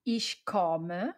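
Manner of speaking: ordinary talk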